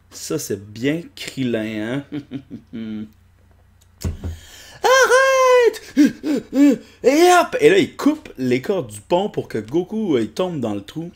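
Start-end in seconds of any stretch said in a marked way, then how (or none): 4.8-7.5 loud, much higher yell; high cartoon shout. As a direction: scream it in a squeaky voice. Personnage crie. (cri aigu)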